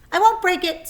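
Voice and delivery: loud, high snarl. A narrator's reading. Templeton snarling at the geese, loud and high. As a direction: snap it back at them. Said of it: "snarled Templeton".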